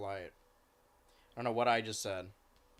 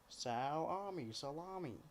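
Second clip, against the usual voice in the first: 1 soft cooing nickname; 4 semitones higher, 8 dB quieter, slower than usual. Real, not acted.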